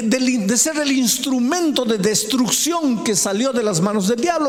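high-pitched, driving sermon delivery; high, insistent pulpit oratory; impassioned preaching. Acted. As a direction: preach it with rising intensity.

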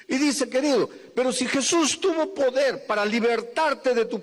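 high, urgent preaching to the congregation; full-force preaching. Acted. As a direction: preach it out, pleading and rising.

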